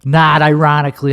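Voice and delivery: normal talking voice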